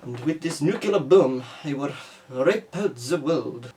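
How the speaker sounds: slow, hammed-up Russian villain accent; cartoonish Russian accent with a menacing edge. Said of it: "moves on to typical, stereotypical Russian".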